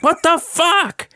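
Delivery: high, drawn-out exasperated cry; pissed-off outburst. Retold or imitated. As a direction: re-enact being fed up, pitch soaring, words stretched. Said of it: "I'm pissed. And so at this point, I'm like".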